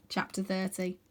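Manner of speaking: usual speaking voice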